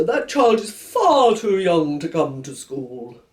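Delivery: slow, stern headmaster voice. Acted. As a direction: lay down the rule, slowly.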